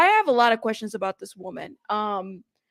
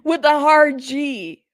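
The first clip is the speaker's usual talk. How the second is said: "hard G" slow and loud; mock insistence; 3 semitones higher, 5 dB louder, slower than usual. Shown as a demonstration. Host trolling viewers over the name, slow emphatic "hard G". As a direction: lean heavily on each word of "hard G".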